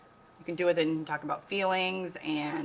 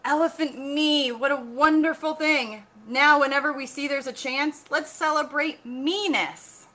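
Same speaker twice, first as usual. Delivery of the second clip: high, loud, slow jubilant reading; joyful celebration; 8 semitones higher, 7 dB louder, slower than usual. A narrator's reading. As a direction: cheer everyone on to dance.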